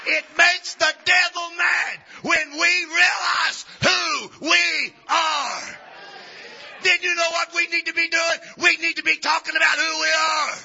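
preacher thundering at the congregation; full-force preaching. Acted. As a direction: preach it to the back row.

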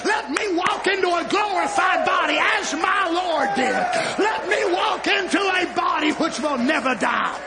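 high-pitched, fervent exhortation; full-force preaching. Real, not acted. Preacher urging believers toward a glorified body, fervent preaching. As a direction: proclaim it with sustained high intensity.

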